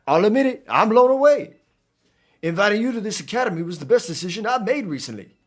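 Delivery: put-on high, effusive Creole-accented character; animated attempt at a Creole accent; Cyrano accent. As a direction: gush in a put-on New Orleans accent, pitched well up. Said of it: "pull out the Cyrano accent".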